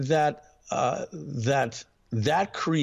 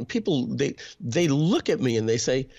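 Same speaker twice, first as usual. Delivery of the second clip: ordinary delivery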